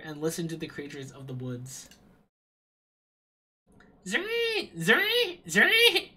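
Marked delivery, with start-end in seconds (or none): 4.0-6.1 much higher piercing chirps; insect call. Pretend. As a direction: mimic a shrill insect cry. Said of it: "supposed to sound like".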